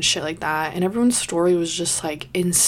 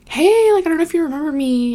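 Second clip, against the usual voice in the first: retold hello, pitched way up; high-pitched greeting; 9 semitones higher, 4 dB louder than usual. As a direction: hail an old acquaintance in a high, louder voice. Retold greeting to former officer.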